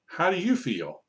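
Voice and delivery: lightly voiced puppet, high then falling; puppet question. Shown as a demonstration. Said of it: "So then you go".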